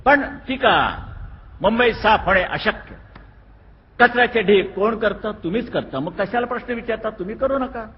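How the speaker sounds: loud, high-pitched speech to a crowd; rally speech. Real, not acted. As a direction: fire up the crowd from the stage. Politician addressing a crowd.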